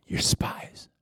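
whispered threat; menacing whisper. Acted. Joseph accusing his brothers, a menacing whisper. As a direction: hiss the accusation softly. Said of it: "Joseph goes".

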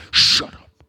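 loud, harsh stage whisper; forceful silencing. Acted. Controlling child threatening sobbing child, forceful loud whisper.

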